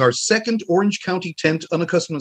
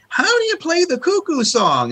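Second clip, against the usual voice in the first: high put-on fan voice; imitated fan question. Imitating fans interrupting Marvin, high put-on voice. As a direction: ask like an eager fan, pitched way up. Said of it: "somebody saying".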